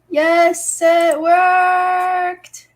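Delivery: high, loud yes it worked; excited relief. Real, not acted. Vlogger relieved the video stopped glitching, excited. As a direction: cheer as the fix finally works.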